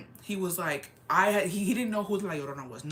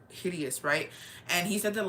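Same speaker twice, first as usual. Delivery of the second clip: ordinary talk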